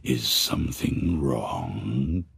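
deep, slow ghoulish politeness; a skull-faced thing asking sweetly; low creepy monster voice. Acted. Horrible thing to the fleeing man, low slow monster voice.

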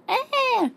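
short high mock wail; baby cry. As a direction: let out a babyish bawl. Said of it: "chorando aí em cima". (chorinho agudo de bebê)

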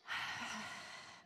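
soft, long breathy exhale; big calming sigh. Shown as a demonstration. Storyteller leads children in calming down, soft long sigh. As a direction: let the whole breath go, softly. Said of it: "Can you do that?".

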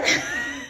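a sudden high squealing laugh. Real, not acted. Laughing at her own begging joke. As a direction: burst into a squeaky giggle.

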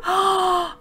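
high, quick intake of breath; delighted gasp. Real, not acted. Player spotting a new sibling option, delighted gasp. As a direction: gasp at a happy discovery.

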